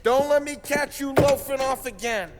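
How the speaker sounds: mocking holler; teasing shout. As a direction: call it over your shoulder, loud and high. Calling back to Ralphie, teasing.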